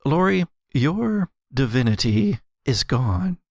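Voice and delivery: ordinary talk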